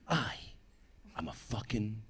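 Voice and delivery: low, halting, cocky murmur; mock bad-boy bravado. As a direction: play it cool, word by word. His cocky answer to the warning.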